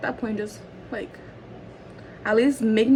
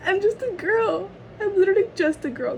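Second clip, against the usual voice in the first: high, softer put-on little voice; mock helpless; 9 semitones higher, 4 dB quieter than usual. Worker excuses herself to viewers, mock helpless. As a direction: playfully plead innocence up high.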